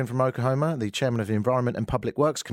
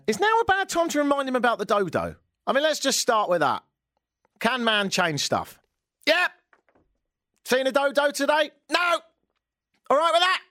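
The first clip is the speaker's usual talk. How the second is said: sarcastic; 13 semitones higher than usual. Real, not acted. Mocking the senator sarcastically.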